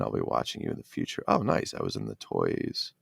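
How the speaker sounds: ordinary talk, nothing marked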